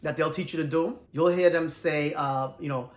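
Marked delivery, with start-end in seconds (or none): none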